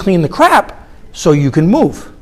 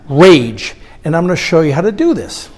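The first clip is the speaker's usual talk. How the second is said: ordinary delivery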